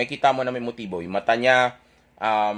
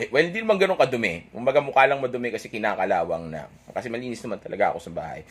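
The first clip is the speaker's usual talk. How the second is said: ordinary talk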